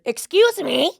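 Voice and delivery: high, loud mock call of a stranger; imitating the woman's loud call; shrill excuse me. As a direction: call out sharply like an annoyed woman. Woman calling out from behind.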